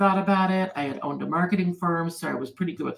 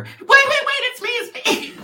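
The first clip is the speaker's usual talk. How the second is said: much higher, louder excited call; mock calling out; 5 dB louder than usual. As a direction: call after her, playfully frantic. Calling after a leaving woman.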